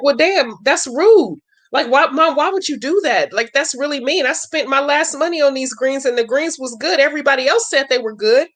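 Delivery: voicing a hurt daughter pleading with Mom. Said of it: "Forty-four is like".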